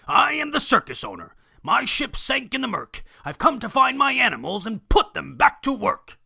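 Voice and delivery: high, loud pompous proclamation; loud and clear. Acted. Circus owner proclaiming his arrival to the town. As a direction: boom it across the town. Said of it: "whose voice was loud and clear".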